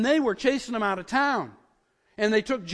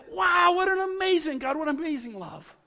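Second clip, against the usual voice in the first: high, ringing exclamation, easing on the last words; excited awe; 8 semitones higher than usual. Acted. Preacher voicing what scripture makes us say, excited awe. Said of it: "can't help but get excited about this".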